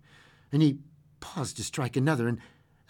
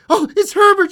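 very high, loud screamed recognition; 13 dB louder than usual. A narrator's reading. Old woman sure her son is knocking, scream. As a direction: scream it at full pitch. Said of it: "she screamed".

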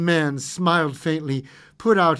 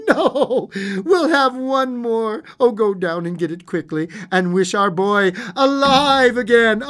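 high, loud, building fervour; triumphant cry; 7 semitones higher, 7 dB louder than usual. A narrator's reading. Wife urges husband to wish son alive, triumphant. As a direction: cry out, exultant and urgent. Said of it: "she cried triumphantly".

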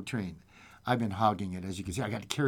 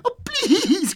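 high, loud pleading wail; hammed-up childlike plea; crying little girl; 8 dB louder than usual. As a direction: beg like a tearful child. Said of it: "cried the little girl".

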